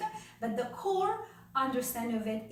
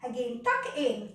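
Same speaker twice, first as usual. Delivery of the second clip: rhythmic counting cues; 4 semitones higher, 3 dB louder than usual. Shown as a demonstration. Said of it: "then we're going to push from under here".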